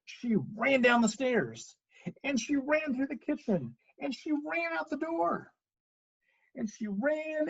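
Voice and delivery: high, stretched-out running refrain; high, urgent storytelling; breathless chase narration. A narrator's reading. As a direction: pitch it up as she flees. Goldilocks flees the bears.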